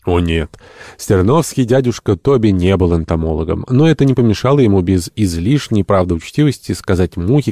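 ordinary delivery, nothing marked

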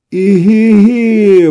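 long high drawn-out cry; exclaiming. A narrator's reading. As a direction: cry out startled, slowly. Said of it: "воскликнул Иван". (протяжное восклицание)

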